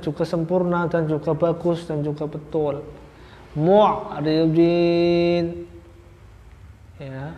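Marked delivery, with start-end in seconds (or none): 3.5-5.5 Mu'ridin with 'ain held in the throat and a long final syllable; raised, stretched recitation of Mu'ridin with a clear 'ain; correct throat articulation. Shown as a demonstration. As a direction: chant Mu'ridin very slowly, pitched higher. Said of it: "sesuai makhrajnya betul".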